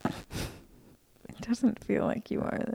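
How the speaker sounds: slow whispered tease; teasing whisper. Real, not acted. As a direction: whisper it slyly.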